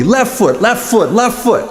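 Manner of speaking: high, insistent repeated nag; sing-out repeated correction in mockery; nagging partner imitation. Partner nagging about left foot.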